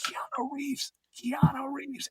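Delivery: urgent stage whisper; loud whisper. Retold or imitated. Secretly alerting the line to the star, whispered. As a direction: hiss the name to tip people off. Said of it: "I'm going like this".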